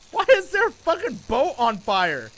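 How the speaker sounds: loud, high disbelief; incredulous. Real, not acted. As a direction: yell it in disbelief. Finds burning boat.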